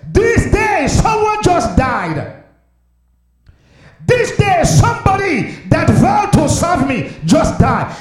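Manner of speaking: high, shouted cries with drawn-out words; full-force preached lament. Acted. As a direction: cry out each phrase like an impassioned preacher.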